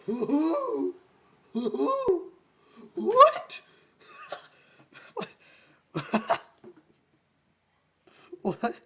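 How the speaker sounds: very high, loud shrieking what with a laugh; stunned disbelief. Real, not acted. Reader shocked by the melon wedding, shrieking. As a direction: squeal in disbelief. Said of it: "reader's stunned reaction".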